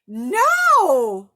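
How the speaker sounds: put-on boy rejecting the torn doll; wailing no. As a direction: howl it like a heartbroken kid. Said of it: "wailed Harry".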